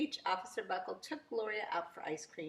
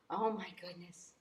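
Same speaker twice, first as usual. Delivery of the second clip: gasping shock; 6 dB quieter than usual. Acted. Gasp at the accident.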